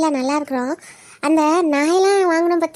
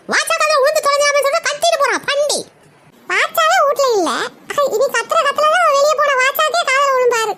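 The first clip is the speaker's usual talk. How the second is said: put-on high, giggling boy's voice, sly; teasing grandson; 5 semitones higher than usual. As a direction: tease grandma with a laugh like a naughty kid. (சிரிப்புடன் கிண்டல் செய்யும் பேரன் குரல்)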